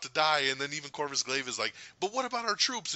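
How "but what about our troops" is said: high-pitched pleading question; mock worried protest. Corvus Glaive to Thanos as retold, mock alarm. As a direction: play an alarmed underling.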